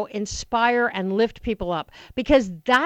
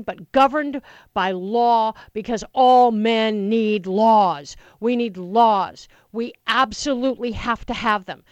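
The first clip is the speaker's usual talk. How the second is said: slow, loud, pounding "laws"; fired-up speech; 5 dB louder, slower than usual. Acted. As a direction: hammer it home like a rally speech. Stump speech for Obama.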